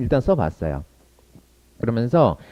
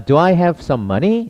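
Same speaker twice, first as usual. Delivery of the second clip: slow, rising on money; model: do I have some money? rising slowly; slow practice question; 5 semitones higher, much slower than usual. Shown as a demonstration. Teacher drills a slow question for students to copy. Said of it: "연습이니까 한번 해봅시다".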